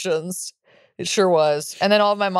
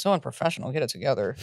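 low, soft grumbled aside; disapproving uncle; 6 semitones lower, 7 dB quieter than usual. Retold or imitated. Uncle griping at a sobbing rabbi, muttered disapproval. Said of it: "and my uncle goes".

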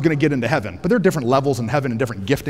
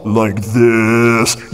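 real low; 8 semitones lower, 3 dB louder than usual. Shown as a demonstration. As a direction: lower voice to shake the subwoofer. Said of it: "And if I talk real low".

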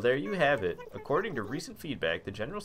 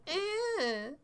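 high squeaky unimpressed villager; bored villager voice; 8 dB louder than usual. Retold or imitated. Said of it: "people in my town are like".

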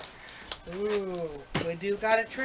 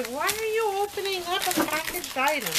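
high mock viewer; 9 semitones higher than usual. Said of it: "you're gonna say".

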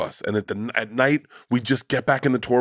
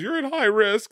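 much higher, girlish mock voice of daughter; mimicking daughter; 13 semitones higher than usual. Daughter warning her dad, in a much higher mock girlish voice. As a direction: squeak it like a worried daughter. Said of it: "She goes".

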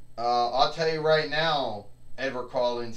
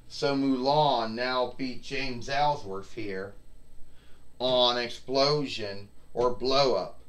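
ordinary delivery

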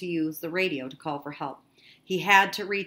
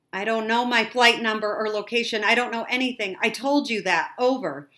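raised, insistent voice; higher, frustrated and urgent; exasperated radio reply; 5 semitones higher, 6 dB louder than usual. A narrator's reading. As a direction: insist loudly that you don't know. Exasperated boy answers operator.

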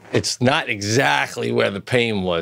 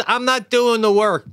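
put-on high bratty kid; 12 semitones higher than usual. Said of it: "your daughter says".

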